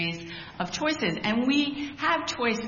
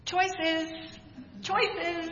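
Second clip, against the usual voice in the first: a knowing, sing-song "Choices" pitched higher; pointed tone; 9 semitones higher than usual. Retold or imitated. Family member's pointed reply to "I'm tired", higher and knowing. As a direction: pitch it up and let it judge. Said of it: "it would be in that tone".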